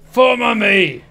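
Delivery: high, loud shouted imitation of the actor; Kiefer Sutherland impression. Gamer doing his best impression of the actor, loud and high. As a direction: belt it out like a gruff action hero. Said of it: "that's my best impression".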